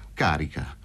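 low, cold one-word reply; frigid. A narrator's reading. Fazio confirming the gun is loaded, frigid. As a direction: answer coolly, dropped low. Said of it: "rispose frigido il Fazio".

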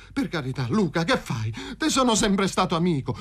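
high, urgent begging; pleading. A narrator's reading. Paroni squirming away from the gun's mouth, pleading. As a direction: beg for your life, pitched high. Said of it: "prese a scongiurare Paroni".

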